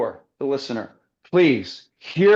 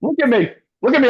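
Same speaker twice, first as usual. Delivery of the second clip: higher, louder excited yelling; 8 semitones higher, 5 dB louder, much faster than usual. Real, not acted. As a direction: call it out loud and high. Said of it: "I'm yelling over here".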